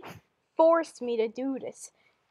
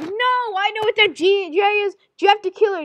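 loud, high cry of recognition; acted alarm; 7 semitones higher, 8 dB louder than usual. Alarmed reply on hearing the name.

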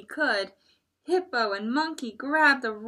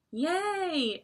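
ordinary delivery, nothing marked

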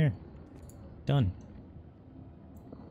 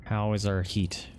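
ordinary talk

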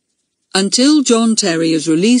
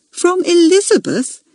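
high, incredulous echo question; astonished; 12 semitones higher, 3 dB louder than usual. A narrator's reading. Head girl querying the junior's claim, astonished. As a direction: repeat it in disbelief, pitched right up.

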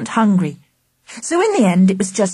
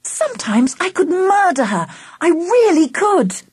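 exasperated; 9 semitones higher than usual. A narrator's reading. Charlie fed up with Angela's prank, exasperated. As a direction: vent it emphatically.

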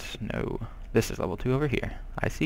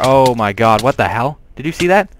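loud, startled exclamation climbing higher; shocked outburst; 3 semitones higher, 10 dB louder than usual. Real, not acted. Player caught out by a sudden game event, shocked. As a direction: blurt it out in loud disbelief.

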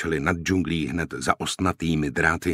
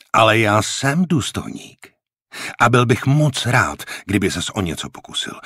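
raised, loud challenge; angry dare; 5 semitones higher, 4 dB louder than usual. A narrator's reading. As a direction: dare him to try it, forcefully. Officer dares the angry corporal.

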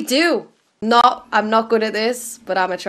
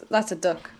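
ordinary talk, nothing marked

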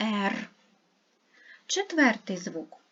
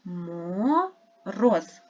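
"Мороз" said slowly as "Мо-роз"; slow syllable reading; 3 semitones lower than usual. Shown as a demonstration. Teacher splitting the word into syllables. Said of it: "скільки у слові складів".

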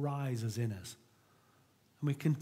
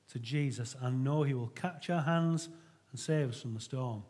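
usual speaking voice

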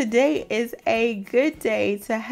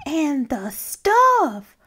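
high, whiny, anxious pigeon; a fretful bird pleading with the reader; the Pigeon's voice; 7 semitones higher than usual. Acted. Pigeon worrying to the reader about school, whiny and high.

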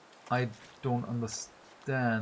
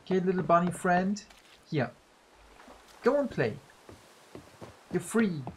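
tender, talking to bunny; 7 semitones higher than usual. Real, not acted. Releases a bunny.

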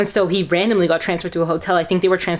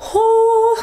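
high, loud whew of relief; relieved whew; 5 dB louder than usual. Real, not acted. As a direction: let the relief burst out. Relief after testing negative.

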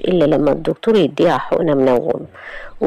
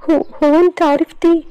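much higher, stammering shock; 12 semitones higher than usual. Acted. Iman stunned that her aunt knows, high and stammering. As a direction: gasp it out, caught off guard. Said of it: "بصدمة قالت إيمان".

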